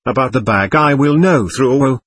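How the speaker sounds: plain reading voice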